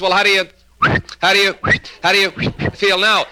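high, sputtering repeats of "how do you"; stuttering psychiatrist. Acted. Psychiatrist questions his patient next day, stuttering. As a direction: get stuck noisily, retry, finally finish. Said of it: "has got the same trouble".

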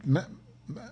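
tripping over the word, voice jumping high; too excited to get the word out; excited stammer. Real, not acted. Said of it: "I'm getting excited".

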